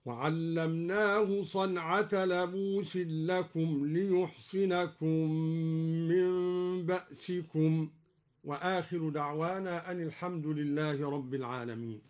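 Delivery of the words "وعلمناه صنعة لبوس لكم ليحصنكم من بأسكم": ليحصنكم with ya, slow chant; liyuhsinakum reading. Shown as a demonstration. Reciter demonstrating Duri reading, slow chanted ليحصنكم. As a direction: read ليحصنكم with ya. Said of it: "هكذا".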